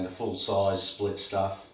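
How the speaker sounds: normal talking voice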